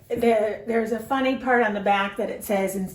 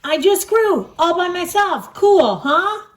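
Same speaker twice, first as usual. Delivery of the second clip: higher, proud little pig; higher proud Piggy; 7 semitones higher, 6 dB louder than usual. Acted. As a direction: brag it in a high piggy voice.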